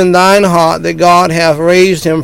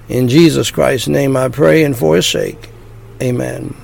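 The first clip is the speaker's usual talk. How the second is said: short phrases with pauses. Shown as a demonstration. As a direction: pause after each phrase for repeating. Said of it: "phrase by phrase".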